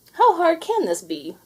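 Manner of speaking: high, loud breezy question; cocky question. A narrator's reading. Allie in the workshop, high and cocky. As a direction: toss it off overconfidently. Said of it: "asked Princess Allie".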